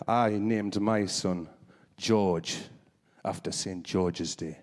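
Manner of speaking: low, slow lad at the bar; big English lad. Retold or imitated. Big English lad at the bar boasting, low and slow. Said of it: "one guy was like".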